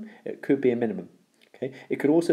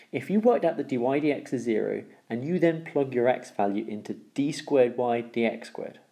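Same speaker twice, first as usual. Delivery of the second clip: usual speaking voice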